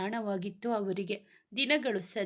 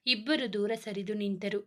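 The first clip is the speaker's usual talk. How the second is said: ordinary delivery, nothing marked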